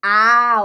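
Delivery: a loud, isolated "A" held as a sample vowel; vowel sound demo. Shown as a demonstration. Showing viewers the vowel she must work on, loud and isolated. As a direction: sound out "A" clearly as the target vowel. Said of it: "Um deles é esse".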